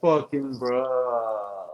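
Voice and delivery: very slow, low SoCal-Southern bro; Southern-SoCal accent. Shown as a demonstration. Showing friends the drunk accent, slow low Southern-SoCal drawl. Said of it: "like, a Southern accent mixed in with SoCal accent".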